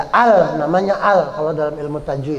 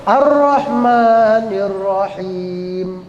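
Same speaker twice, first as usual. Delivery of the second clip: drawn-out chant, ending on a stop; slow recitation; much slower than usual. Shown as a demonstration. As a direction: recite slowly with a pause at the end. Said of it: "kalau kita baca wakof".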